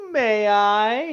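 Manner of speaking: hammed-up plea. [name] asks with hand extended.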